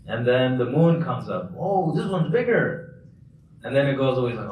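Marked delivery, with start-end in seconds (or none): 1.6-3.1 high, slow put-on wonder; exaggerated fake amazement, pitched up; mock awe. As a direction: ooh and aah sarcastically at a new find. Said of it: "kind of using sarcasm".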